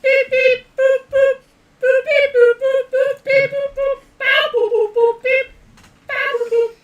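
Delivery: very high beeping robot chatter; toy robot voice. Acted. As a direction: beep and boop like a tiny robot. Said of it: "In English, that means".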